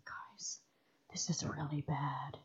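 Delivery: hushed, dead-serious whisper. Retold or imitated. Friend warning the crew the Wendigo is after them, grave whisper. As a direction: warn the group as if the Wendigo could hear. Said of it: "he was so serious".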